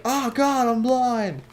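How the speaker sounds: high squealing cry of disgust; mock horror. Guy tricked into looking, high horrified yelp. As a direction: yelp as if blinded by what he saw. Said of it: "he's like".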